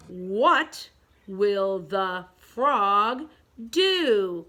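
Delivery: very slow, drawn-out question; slow read-along. Shown as a demonstration. Teacher leads pupils through second picture, very slowly. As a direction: stretch each word, pausing before DO.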